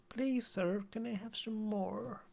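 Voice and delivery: high, soft pleading in a put-on American accent; mock American accent. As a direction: beg sweetly in a hammed-up American accent. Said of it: "doing an American accent".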